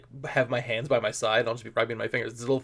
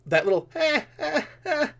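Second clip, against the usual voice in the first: short high nasal-free "eh" grunts, repeated; a little vocal tic to snap into character; character tic noise. Shown as a demonstration. Improviser demonstrating his character's vocal tic, high repeated "eh". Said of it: "when you use a noise to start your character off".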